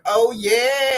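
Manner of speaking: high loud oh yeah; excited shout. Real, not acted. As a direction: hype yourself up. Twerking hype.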